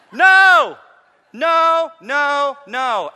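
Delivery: high, very loud cry, stepping down in pitch; emphatic shouted rejection. Real, not acted. Preacher rejecting Hollywood's Jesus, loud emphatic shout. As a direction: shout down the idea, each word separate.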